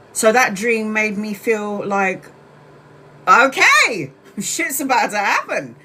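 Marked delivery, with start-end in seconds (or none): 3.3-5.8 higher, louder, half-laughing okay; laughing resolve. Retold or imitated. Telling viewers the dream spurred her on, laughing resolve. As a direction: laugh it off, fired up.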